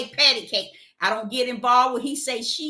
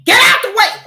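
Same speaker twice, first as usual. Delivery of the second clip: loud barked order; angry shout; 10 semitones higher, 13 dB louder than usual. Retold or imitated. Coworker ramming a cart, shouting angrily. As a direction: shout at her to move. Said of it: "she took that darn cart and rammed it".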